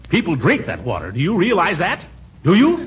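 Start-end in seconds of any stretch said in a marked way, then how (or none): 1.1-2.9 scolding. Acted. Scolding nephew.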